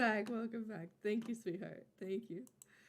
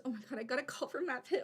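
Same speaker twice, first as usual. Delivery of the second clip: ordinary talk, nothing marked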